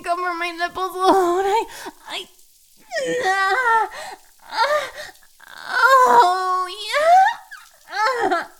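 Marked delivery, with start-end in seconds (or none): 3.2-8.5 high, loud moans breaking into a laugh; gasping, overwhelmed cries; crying moans. Acted. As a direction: cry out higher and higher. Overwhelmed in intimate roleplay scene.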